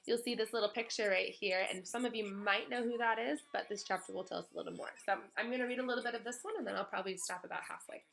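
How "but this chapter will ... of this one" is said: normal talking voice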